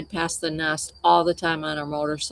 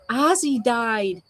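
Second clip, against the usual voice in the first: slow, higher, mock-tearful wail; tearful news; 6 semitones higher, slower than usual. Telling mom at Steak 'n Shake the eagle died, slow tearful wail. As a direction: drag it out like you're still crying. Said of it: "I'm like".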